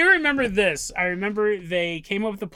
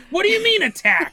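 incredulous; 11 semitones higher, 4 dB louder than usual. Retold or imitated. Incredulous retold character quote.

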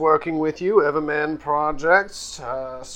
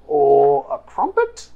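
high voice; 5 semitones higher, 5 dB louder than usual. Acted. Mocking a picky NPC's son.

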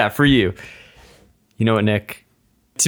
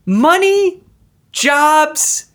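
higher, louder, slow sudden realization; rising epiphany; 12 semitones higher, 3 dB louder, slower than usual. Acted. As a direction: build to a big revelation. Building epiphany speech.

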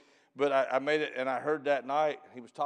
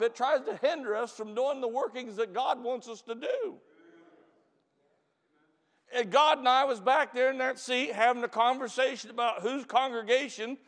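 louder, higher fervent sermon voice; preaching; 12 semitones higher, 6 dB louder than usual. Acted. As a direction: warn the congregation with fire. Back to preaching after story.

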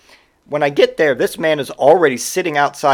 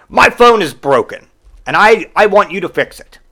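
higher and louder, insistent; demanding customer; 5 semitones higher, 6 dB louder than usual. Retold or imitated. Customer demands the clerk fix his phone, loud. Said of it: "And he goes".

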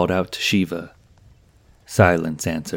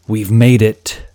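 louder, higher call over the wind; yelling; 3 semitones higher, 7 dB louder, slower than usual. A narrator's reading. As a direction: shout it over a howling storm. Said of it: "He had to yell to be heard".

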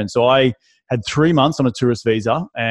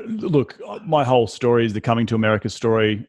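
normal talking voice

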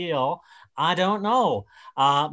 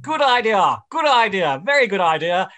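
higher, louder mimicry of a co-host's catchphrase; Richard Fitzwilliams impression; 5 semitones higher, 7 dB louder than usual. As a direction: chirp it up, loud and high, in mock imitation. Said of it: "not sure that that was a very accurate imitation of my voice".

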